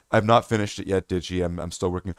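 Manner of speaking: tired reply while still at work; low weary Ads. Retold or imitated. Ads answering lowly.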